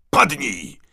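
high, loud warning cry; sudden yelled command to get down; urgent shout. A narrator's reading. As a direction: shout it out without a second's thought. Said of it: "Bez zastanowienia krzyknął".

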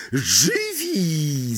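high, theatrical cry; hammed-up surprised shout; exclaimed mock astonishment. A narrator's reading. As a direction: call out as if amazed. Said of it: "zawołał Sir Patryk".

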